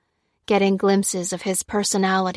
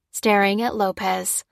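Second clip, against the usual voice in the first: plain reading voice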